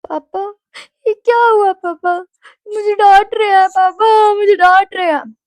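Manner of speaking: high, loud wail to dad; hammed-up tearful complaint; imitated crying. Daughter calling papa after a scolding, mock crying. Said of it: "क्योंकि I started crying".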